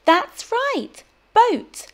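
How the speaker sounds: high bright cheer; excited praise. Shown as a demonstration. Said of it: "Can you see something that starts with B".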